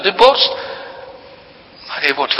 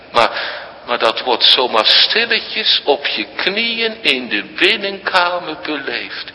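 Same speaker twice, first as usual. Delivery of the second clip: ordinary talk, nothing marked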